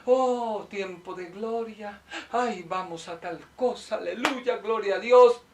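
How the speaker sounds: hammed-up hallelujah voice; mock pious praise. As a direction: imitate a showy believer praising God. Mimics showy worshippers praising.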